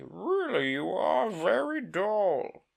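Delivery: high, loud and slow scolding; annoyed turtle. Acted. The Mock Turtle snapping at Alice, loud and slow. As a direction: drag out a haughty put-down. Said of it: "said the Mock Turtle angrily".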